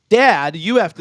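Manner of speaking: hammed-up forced familiarity; forced "Dad". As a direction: imitate laying it on thick. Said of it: "so funny how they force it".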